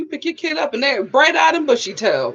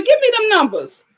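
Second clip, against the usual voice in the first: higher-pitched, quick-fire demand; eager demand; 7 semitones higher than usual. Real, not acted. Host asking a viewer for daycare prices, eager demand.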